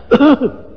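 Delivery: a pointed little cough to rouse the dozing wife; fake cough. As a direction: cough on purpose to wake her. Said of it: "aku batuk gitu".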